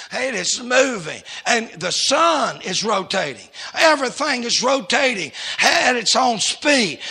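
emphatic pulpit cadence, building in volume; building preaching. Real, not acted. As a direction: drive each point home to the congregation. Preaches with rising force.

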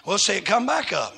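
ordinary talk, nothing marked

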